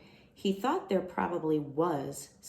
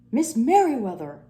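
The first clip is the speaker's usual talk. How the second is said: loud higher call down the hall; 6 semitones higher, 11 dB louder than usual. Acted. Librarian calling his boss down the hall, loud. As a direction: call her name across the library.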